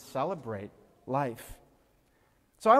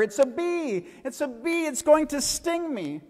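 put-on high, loud panicked squeal of a little girl; little girl shrieking to her father over a bee, terrified; 13 semitones higher, 4 dB louder than usual. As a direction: shriek for Daddy as if the bee is on you. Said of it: "she squealed in terror".

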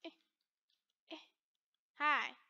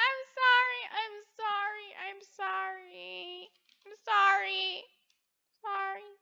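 mock pleading apology; 3 semitones higher, 6 dB quieter than usual. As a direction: beg forgiveness in a small, put-on voice. Mock begging for forgiveness.

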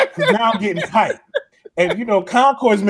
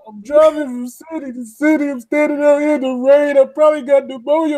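higher, louder, dramatic pleading; 9 semitones higher, 4 dB louder than usual. Retold or imitated. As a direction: wail it like a heartbroken R&B star.

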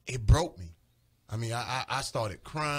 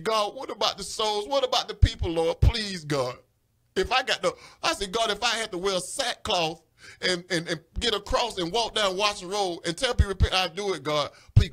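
pleading; 6 semitones higher, 4 dB louder than usual. Retold or imitated. Begging God for the souls.

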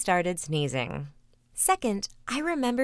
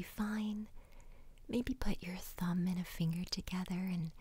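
guided meditation whisper; 9 dB quieter than usual. Acted. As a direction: whisper them gently into calm.

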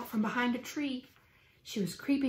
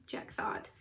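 usual speaking voice

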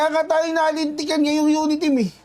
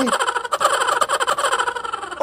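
high, long incredulous laugh; a real high cackle of disbelief; burst of laughter; 11 semitones higher than usual. Real, not acted. Laughing at Duterte's arrest.

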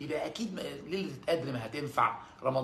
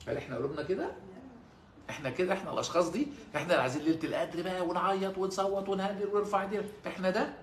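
ordinary delivery

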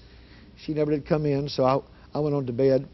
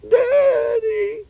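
squeaky scared voice; much slower than usual. Retold or imitated. Terrified daughter at her father's bedroom door, squeaky wail. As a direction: squeak it out as if the voice barely comes. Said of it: "little squeaky voice".

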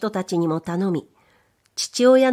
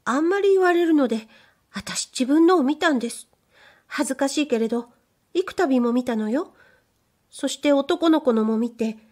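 higher, bashful maid's voice; shy young maid; 6 semitones higher than usual. Acted. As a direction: play the timid young servant girl. Maid confesses her shame.

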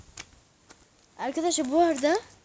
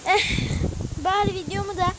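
raised voice; 9 dB louder than usual. Real, not acted. Talking over strong wind outdoors.

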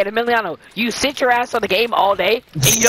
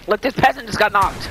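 ordinary talk, nothing marked

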